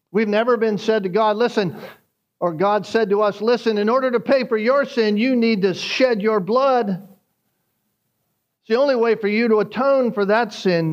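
fervent sermon delivery; preaching. Acted. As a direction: preach it out to the congregation with rising force. Preaching at full force.

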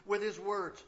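loud, slow, emphatic preaching; fiery pastor pressing the congregation; preaching at full force. Acted. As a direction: drive the point home from the pulpit.

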